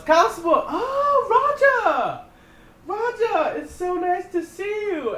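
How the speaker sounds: very high, loud, gushing welcome, slowing at the end; overjoyed fake greeting. Finn greeting the constable Roger, very high and loudly gushing. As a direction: gush over an old friend to cover up. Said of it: "he goes".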